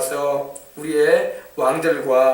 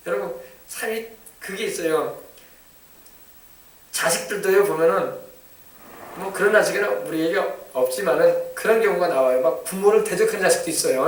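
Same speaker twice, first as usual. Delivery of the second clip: normal talking voice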